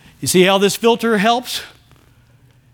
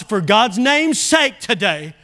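usual speaking voice

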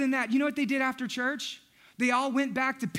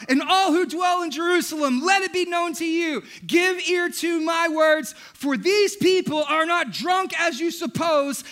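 preaching shout; 5 semitones higher, 4 dB louder than usual. Acted. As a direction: proclaim it to the whole crowd. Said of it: "he lifted up his voice".

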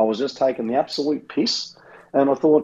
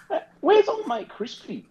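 mock high call. Mock calling out for missing mate.

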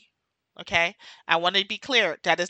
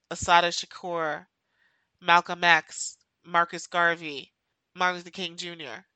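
ordinary talk, nothing marked

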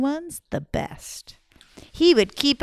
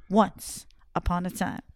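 ordinary delivery, nothing marked

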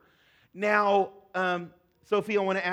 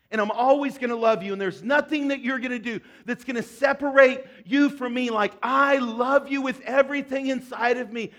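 raised, driving sermon delivery; impassioned preaching; 5 semitones higher, 4 dB louder than usual. Acted. Pastor to congregation on God's unfailing love, fervent preaching. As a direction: preach it with full conviction, high and strong.

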